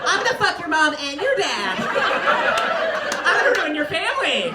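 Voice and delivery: a lightly voiced home-wrecker, relishing it; a mock sleazy step-parent gloating at the kids.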